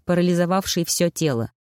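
ordinary delivery, nothing marked

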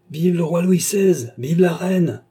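high, loud, slow cry of mock acclaim; loud, high shouted hail, dripping with scorn; strident cry. A narrator's reading. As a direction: hail the new king as if insulting him. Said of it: "cria le jeune homme d'une voix stridente".

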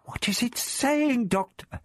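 high, anxious voiced whisper; anxious whispering girl. A narrator's reading. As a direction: ask urgently in a small high girl's voice. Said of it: "Vicky whispered above the deep".

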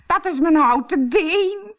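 high, loud mock-bride squeal; giggly. A narrator's reading. Harlekijn in disguise explains the noise, giggly. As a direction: titter it in a put-on squeak. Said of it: "giechelde Harlekijn".

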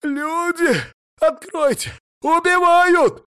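very high, strained screams for help; scream, stretching the last word out loud; desperate screaming. A narrator's reading. Hunters pounding on a locked door, screaming in terror. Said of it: "сорванными глотками орали".

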